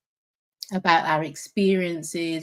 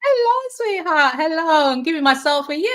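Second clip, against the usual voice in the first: gleeful greeting; 10 semitones higher, 5 dB louder than usual. Real, not acted. Guest greets host onstage gleefully.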